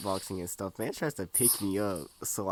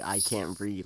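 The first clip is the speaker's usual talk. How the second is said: normal talking voice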